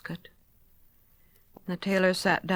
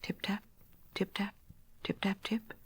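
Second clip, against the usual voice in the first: hushed, tiny tapping sounds; soft little tapping; 9 dB quieter than usual. A narrator's reading. Tiny noises under the teacup, hushed tapping. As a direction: tap the words out softly like mice. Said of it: "there came other little noises".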